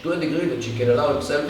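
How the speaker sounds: usual speaking voice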